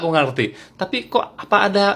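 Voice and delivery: ordinary delivery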